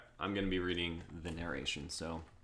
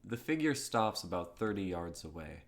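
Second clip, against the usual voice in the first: measured stage direction; script narration. A narrator's reading. Narrator describes the approaching figure, steady read.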